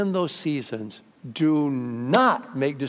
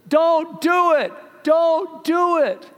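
much higher, louder, slow "Don't do it" called out; calling from a car; 14 semitones higher, 6 dB louder, much slower than usual. Retold or imitated. Stranger in a passing car calls to a woman on a bridge, slow pleading shout. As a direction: call "Don't do it" loud and slow from a car window. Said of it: "rolled down the window and says".

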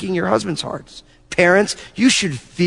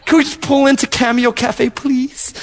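higher, half-laughing plea; mock pleading with a cop; 6 semitones higher than usual. Pastor pulled over, begging the cop, mock pleading. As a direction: beg the officer, half laughing. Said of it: "And I'm like".

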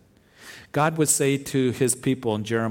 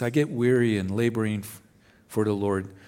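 ordinary talk, nothing marked

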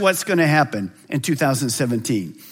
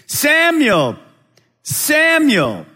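higher, louder call of a name; God calling Samuel; 9 semitones higher, 3 dB louder, much slower than usual. Acted. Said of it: "calling as at the other times".

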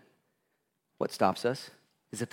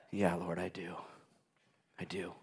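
much softer, lower, humbled reply; humbled whisper; 4 semitones lower, 10 dB quieter than usual. Acted. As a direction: answer barely audible, humbled. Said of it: "humbled answer to the Lord".

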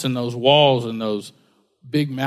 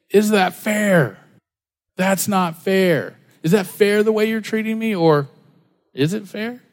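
indignant complaint; 4 semitones higher than usual. Acted.

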